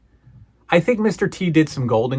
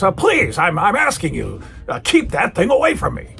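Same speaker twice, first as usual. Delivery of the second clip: exasperated plea, pitched up; McMahon; 5 semitones higher than usual. Retold or imitated. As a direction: imitate a frustrated man snapping mid-plea. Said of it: "McMahon snaps and goes".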